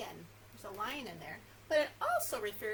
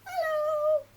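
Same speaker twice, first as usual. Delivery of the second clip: squeaky high mouse hello; very high, loud squeak; as high as possible; 14 dB louder than usual. Shown as a demonstration. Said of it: "as high as we can".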